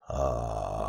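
very low, soft tone pushed out on air; airy low tone. Shown as a demonstration. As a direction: push air through a long, deep "uh". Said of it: "Push more air out".

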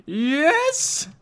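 high, drawn-out excited cry; thrilled. Real, not acted. Player finding an iron sword, thrilled. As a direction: crow over a lucky find.

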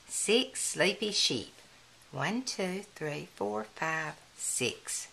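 ordinary delivery

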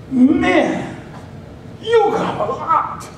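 high, slow marvelling; delighted at how much is there; amazed God. Acted. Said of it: "And God sees it and says".

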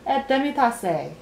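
careful. A narrator's reading. King rising to give the last speech, carefully. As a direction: pronounce each French dish with care. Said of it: "he said carefully".

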